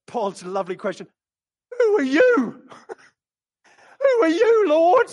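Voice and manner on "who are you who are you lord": high, loud desperate cry to the Lord; Paul crying out. Acted. Paul struck down on the road, crying out high. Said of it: "And he said".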